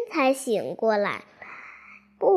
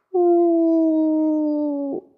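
sobbing wail; 3 dB louder than usual. Acted. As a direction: let out a crying wail. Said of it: "不禁大哭起来".

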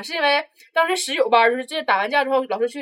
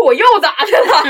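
higher, louder retort dissolving into laughter; laughing retort; 8 semitones higher, 10 dB louder than usual. Retold or imitated. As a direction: fire back, then crack up. (笑着顶嘴)

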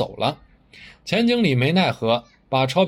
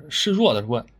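ordinary delivery

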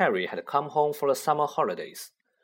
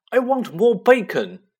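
high, loud whiny demand; spoiled boy demand; 10 semitones higher, 8 dB louder than usual. Acted. Spoiled son demands bacon from mother, high whine. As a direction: whine like a greedy spoiled child.

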